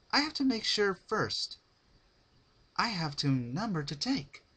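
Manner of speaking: high, eager scheming chirp; bright and giddy, plotting; Pinkie Pie voice. Acted. Pinkie plans to herself.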